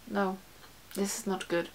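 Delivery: ordinary talk, nothing marked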